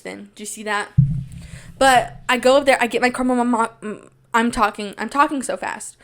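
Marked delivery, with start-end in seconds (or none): none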